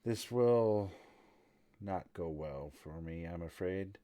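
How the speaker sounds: soft, low resignation; big sigh. Acted. Lady fearing for herself at the news, resigned and sighing. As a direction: let it sink away on a sighing breath. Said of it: "letting it out in a big sigh".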